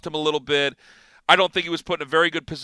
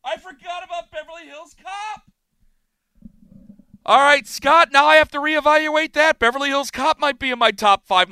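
excited; 10 semitones higher, 4 dB louder than usual. Real, not acted. Host remembers a forgotten favourite movie.

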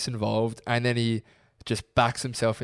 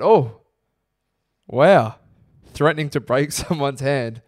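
mock amazement; 3 semitones higher, 8 dB louder than usual. Mocking the fan's threat.

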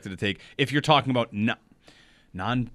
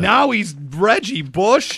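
higher, slow, drawn-out disbelief; mock amazement; 6 semitones higher, 4 dB louder, much slower than usual. Host scoffing at preseason hype, mock amazement. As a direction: stretch it out incredulously.

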